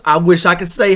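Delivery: ordinary talk, nothing marked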